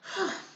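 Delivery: a quiet read-aloud sigh; soft sigh. Acted. Spinderella tired of her family, soft sigh. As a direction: breathe out a little sigh. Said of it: "Spinderella sighed".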